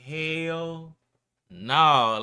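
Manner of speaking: drawn out loud Hell no; emphatic. Retold or imitated. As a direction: stretch it into a shocked refusal. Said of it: "I'm like".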